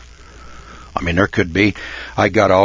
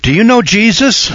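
high, eager door-to-door preacher; door knocker; 11 semitones higher, 4 dB louder than usual. Retold or imitated. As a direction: pitch it like a cheerful evangelist at the door. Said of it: "somebody knocks on my door and say".

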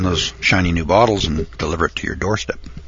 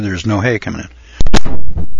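emphatic declaration; 9 dB louder, slower than usual. Acted. Punchline of the hay shortage.

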